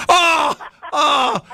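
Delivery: high shouted oh, oh; shocked outcry. Real, not acted. Host reacting to the caller's joke, shocked shout. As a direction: cry out as if wounded by the joke.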